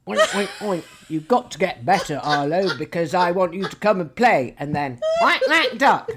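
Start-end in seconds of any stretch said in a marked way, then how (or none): 0.1-4.6 loud, squeaky toy pig; Piggy voice. Pretend. Toy pig cheering up a poorly grandson, loud and squeaky. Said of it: "and Piggy says". 5.2-6.1 high, loud quacking; duck quack. Pretend. Grandma quacking for her grandson, high and loud. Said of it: "my duck impression is really good".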